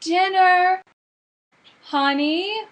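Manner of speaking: calling out. Acted. Calling dinner again loudly.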